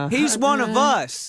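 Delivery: high, drawn-out mock admiration; hammed-up fan of a folksy politician; mock impressed voter. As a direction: gush slowly in a high, put-on voice. Mock voters falling for fake Southern talk.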